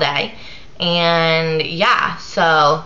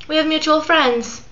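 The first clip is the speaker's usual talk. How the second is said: faux-sweet message; 9 semitones higher than usual. Shown as a demonstration. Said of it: "with a smiley face".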